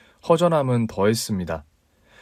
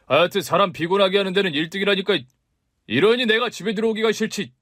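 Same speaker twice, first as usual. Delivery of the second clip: much higher, fast irritated rant; exasperated husband; 9 semitones higher, faster than usual. Acted. (귀찮다는 듯 빠르게 쏘아붙이는 남편)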